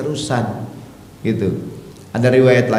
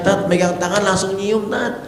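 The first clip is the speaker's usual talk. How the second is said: high whining complaint; higher, whining wife voice; whiny wife; 7 semitones higher than usual. Retold or imitated. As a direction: tattle on the husband to the ustaz.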